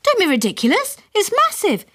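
high, incredulous mum voice; mother, disbelieving. A narrator's reading. Mother to father about the nest: high, disbelieving. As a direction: scoff at the idea.

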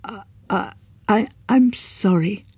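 nervous sorry; stammered apology. A narrator's reading. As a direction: stumble into sorry. Young man stammering apology.

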